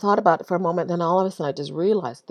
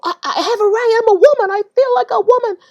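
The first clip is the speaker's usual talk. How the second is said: high, loud mocking imitation of him; mock his whiny claim, pitched high and loud; mocking the man; 14 semitones higher, 8 dB louder than usual. Speaker mocking the man who insists he is a woman, high and loud. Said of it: "he stopped again and he said".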